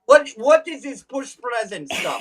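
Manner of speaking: high, baffled Russian immigrant; Russian accent. Acted. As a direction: puzzle over it in a hammed-up Russian accent. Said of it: "say them in Russian for you".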